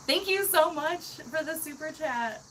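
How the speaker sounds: high-pitched, gushing thanks; grateful. Real, not acted. Streamer thanking a viewer for super chat, grateful.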